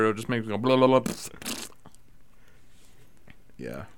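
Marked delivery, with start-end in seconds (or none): 0.6-1.1 blah, blah, blah run together as loose-tongued babbling; tongue-tied babble. Shown as a demonstration. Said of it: "such a tongue twister".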